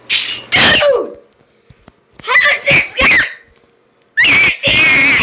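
high, loud screaming outburst; raging shout. Real, not acted. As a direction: yell it in frustrated gamer rage. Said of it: "Why do I rage so much".

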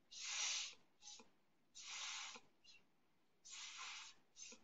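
soft unvoiced s hisses in a two-beat pattern; hissing pattern. Shown as a demonstration. Teacher models silly snake hisses for the class, soft unvoiced hissing. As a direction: hiss like a snake, softly, on the beat. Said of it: "make some really silly s noises".

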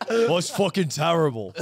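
mocking a friend in a Peaky Blinders accent, through laughter; exaggerated Peaky Blinders-style British impression. Teasing a friend by copying them, mock Peaky Blinders accent.

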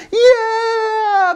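very high, drawn-out squeal; hammed-up kiddie excitement; overjoyed yes. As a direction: squeal with sudden delight. Instantly won over by cookie.

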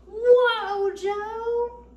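loud high call to stop the horse. Acted. Storyteller calls to horse, loud high whoa.

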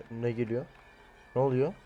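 normal talking voice

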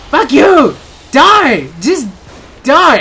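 high, loud furious yelling; angry shout. Real, not acted. Gamer fighting back at the creature, furious loud yell. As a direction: yell at the monster to die.

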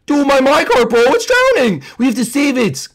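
high, loud, frantic wail; panicked cry. Acted. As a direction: cry out as if someone is drowning. Toy cart seen drowning.

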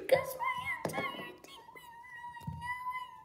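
very high, wobbly crying voice; fake crying voice. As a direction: whimper it out like a sobbing kid. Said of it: "It's hard making a crying voice".

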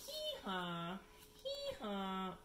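two-note donkey bray; baby donkey. Acted. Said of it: "the baby donkey says".

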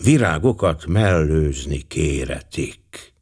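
ordinary delivery, nothing marked

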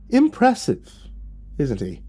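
high, drawn-out first word, dropping away; growling devil. Acted. Lucifer as mountain lion behind narrator, mock-admiring growl. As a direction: purr admiration as a devilish beast. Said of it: "a voice growled from behind me".

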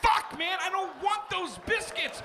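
high-pitched exasperated complaint; whiny protest. Acted. Customer stuck with unwanted biscuits, whiny protest. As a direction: whine it high, fed up.

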